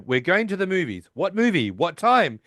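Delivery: mock Sheldon reading the note. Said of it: "he goes".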